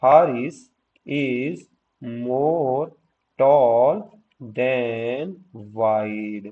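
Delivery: measured teacher's dictation, pausing after each word; model sentence. Shown as a demonstration. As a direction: dictate it word by word with clear gaps. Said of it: "तो आप क्या कहोगे".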